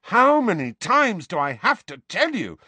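high, loud exasperation; rising temper. A narrator's reading. Boy fed up with uncle's accusations, rising temper. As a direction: let temper and voice climb. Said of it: "temper and voice both rising".

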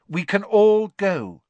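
slow, high, loud hint; pointed. A narrator's reading. Hermione hinting to Ron they should leave, pointedly. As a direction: say it pointedly, weighting every word. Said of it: "said Hermione pointedly".

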